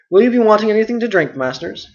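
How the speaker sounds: hurry-up reply from another room; Nob's eager reply. Acted. Nob serving.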